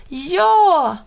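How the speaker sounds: high, loud happy cry; little brother shouts with delight; shouted yes. A narrator's reading. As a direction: yell it out with joy. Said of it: "ropade lillebror".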